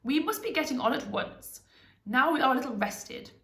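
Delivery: rally the party as a wizard; lightly voiced, commanding wizard; urgent wizard. A narrator's reading. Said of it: "called them to their senses".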